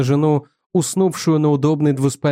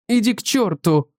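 cried out; 7 semitones higher than usual. A narrator's reading. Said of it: "вскричала жена".

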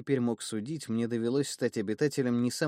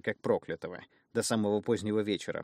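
plain reading voice

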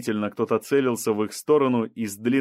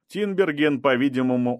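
usual speaking voice

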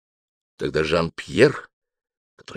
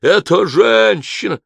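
very high, loud, drawn-out cry; frantic stretched exclamation; wild cry; 12 semitones higher, 8 dB louder, slower than usual. A narrator's reading. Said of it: "дико вскричал Жан-Пьер".

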